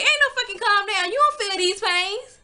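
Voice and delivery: high, loud heated snap; angry retort. Retold or imitated. She refuses to calm down for her partner, high loud anger. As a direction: bite back in fury.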